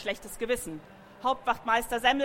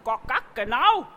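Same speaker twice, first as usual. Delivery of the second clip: clucking hens; 5 dB louder than usual. A narrator's reading. Hen ladies scolding the horses, clucking and indignant. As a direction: cluck gock gack then a louder genau. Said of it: "schimpften die Hühnerdamen hinterher".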